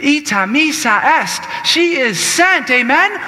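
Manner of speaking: loud, high preacher call; full-force preaching. Acted. Preacher rallying the congregation with a loud call. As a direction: rouse the congregation.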